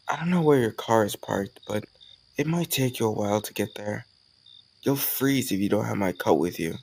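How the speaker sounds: usual speaking voice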